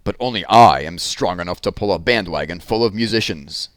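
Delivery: lumber through it, sure of your strength; the ox bragging he alone can pull it; boastful ox. Acted.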